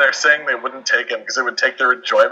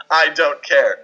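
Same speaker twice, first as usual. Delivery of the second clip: normal talking voice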